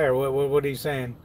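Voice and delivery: ordinary delivery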